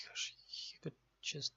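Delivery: whisper. Real, not acted. Muttering to self at screen.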